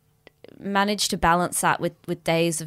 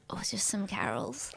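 soft unvoiced whisper; 11 dB quieter than usual. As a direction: hush it playfully. Continuing the whisper bit.

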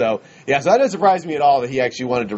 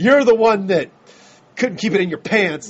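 mock angry Harrison; 5 semitones higher than usual. Harrison yelling at Chewie fan.

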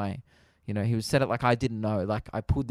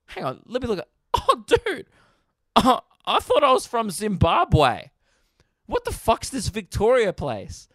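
mock clueless self; 9 semitones higher, 4 dB louder than usual. Mocking what the officer expected, high and daft. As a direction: play an idiot who forgot where he lives. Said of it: "As if I was gonna be like".

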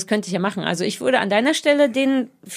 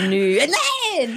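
higher, mock-outraged refusal; indignant no; 4 semitones higher than usual. Shown as a demonstration. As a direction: play the offended one with a sharp no. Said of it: "dass du das sehr entrüstet sagen möchtest".

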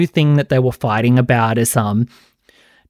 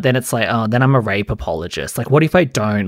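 ordinary delivery

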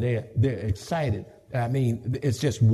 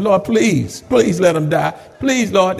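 louder, half-joking plea to the Lord; mock pleading prayer; 3 semitones higher, 7 dB louder, slower than usual. Pastor mock-praying over a difficult member, louder plea. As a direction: beg God in a mock-earnest prayer. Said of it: "some pastors say".

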